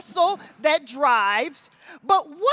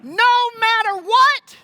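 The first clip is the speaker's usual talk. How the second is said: full shouted war call, higher; battle cry; 7 semitones higher, 5 dB louder than usual. Acted. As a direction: shout it to rouse the crowd. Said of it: "one more try".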